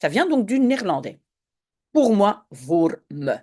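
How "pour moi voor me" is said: ordinary talk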